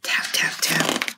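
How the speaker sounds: whispered tap tap tap; soft tapping. Acted. Reader making the chick tapping its shell, whispered. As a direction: tap it out quietly.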